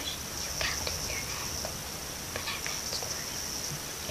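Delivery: soft self-mocking whisper. Real, not acted. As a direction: murmur it like a secret to yourself. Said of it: "aside, whispered".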